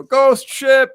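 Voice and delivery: high, loud shriek; mermaids shrieking. Retold or imitated. Mermaids shrieking a warning before jumping overboard, high and loud. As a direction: shriek the warning as you leap.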